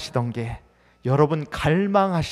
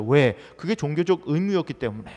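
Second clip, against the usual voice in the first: ordinary talk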